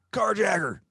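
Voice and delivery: a high, loud playful yell of carjacker; mock hollered greeting; retold shouted greeting. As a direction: bellow the nickname down the phone like an old friend. [name]'s greeting on the phone.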